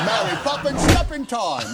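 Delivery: sing-song cockney like a chimney sweep; Mary Poppins cockney. Retold or imitated. Quoting Mary Poppins for the host, in a cockney accent. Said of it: "That's your best accent".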